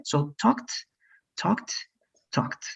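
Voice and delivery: talked with a crisp final t sound, said slowly; clear final t in talked. Shown as a demonstration. Teacher modelling for student: talked ending crisply on t. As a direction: tap the t at the end of talked. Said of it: "I'm going to repeat for you once again".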